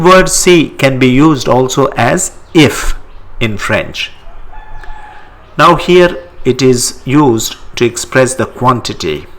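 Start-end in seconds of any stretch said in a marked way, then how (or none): none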